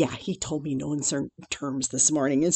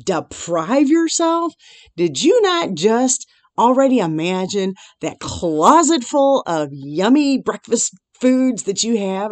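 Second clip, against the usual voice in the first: chastising God; 5 semitones higher, 5 dB louder than usual. Retold or imitated. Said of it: "He says".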